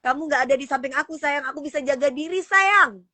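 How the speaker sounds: high, mock-indignant lovey protest; playful sweetheart boasting. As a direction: play-act bragging to a worried sweetheart. (manja pura-pura membela diri dengan nada tinggi)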